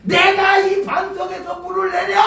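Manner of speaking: furious high shout; angry Moses. Retold or imitated. Preacher voicing Moses shouting in rage at the rock. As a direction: roar it as Moses striking the rock. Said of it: "머리끝까지 화가 난 모세가".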